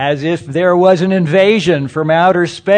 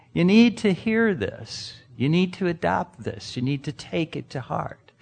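each line softer until much softer; fading earnest urging; 7 dB quieter than usual. Real, not acted. Preacher urging the congregation, fading to much softer. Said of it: "preacher urging congregation, fading".

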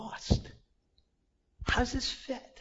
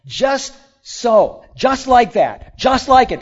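higher, louder, slow emphatic; voicing Jesus; 7 semitones higher, 13 dB louder, slower than usual. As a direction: proclaim it like the teacher himself. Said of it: "He says".

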